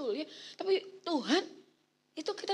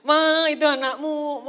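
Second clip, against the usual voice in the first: slow, much louder shouted call; husband calling wife; 13 dB louder, slower than usual. Retold or imitated. Husband shouting to wife about their child, slow loud call. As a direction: shout it across the house, drawn out. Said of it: "Pak Eri berkata".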